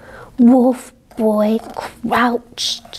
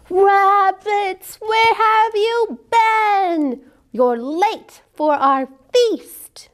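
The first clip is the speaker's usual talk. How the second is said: higher, slow, fading to a hush; wolf boy drawing out a greeting; 7 semitones higher, much slower than usual. A narrator's reading. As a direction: greet the rabbits with a lightly voiced wolf boy.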